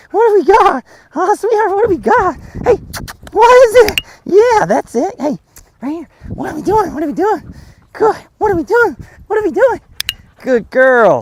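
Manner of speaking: high-pitched, excited dog talk; happy Mickey Mouse voice. Shown as a demonstration. Trainer being exciting for the dog, happy high voice. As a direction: coo and tease in a squeaky, excited voice. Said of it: "a happy voice or Mickey Mouse voice".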